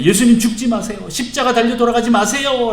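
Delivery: high, loud acted plea; desperate pleading. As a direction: beg him urgently, clinging on. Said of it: "옷을 붙잡으면서". (붙잡고 높은 목소리로 애원하듯)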